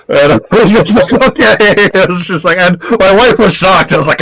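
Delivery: high-pitched, laughing through the words. Real, not acted. Laughing while telling the punchline.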